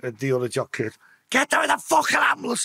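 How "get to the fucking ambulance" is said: urgent high-pitched yell; retold shout. Shouting at his bird and Dave for the ambulance, urgent retold shout. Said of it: "I'm shouting t-, to his bird and Dave".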